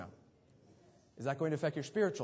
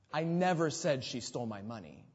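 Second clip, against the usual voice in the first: "never" punched out; emphasize "never" above all other words; stress on NEVER. Shown as a demonstration. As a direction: hit the word "never" hardest. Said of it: "emphasize a different word".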